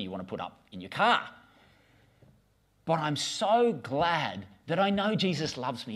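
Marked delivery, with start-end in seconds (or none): 2.8-6.0 sincere testimony. Real, not acted. Heartfelt testimony.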